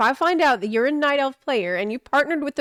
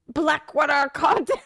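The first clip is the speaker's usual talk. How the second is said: pirate goblin voice; 3 semitones higher than usual. Shown as a demonstration. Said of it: "make that sound like a pirate".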